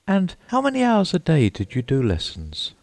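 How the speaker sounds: put-on high hurried girlish voice; Alice hurrying. A narrator's reading. Alice hastily asking the Mock Turtle, high and hurried. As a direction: pitch up, rush to change the subject. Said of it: "in a hurry to change the subject".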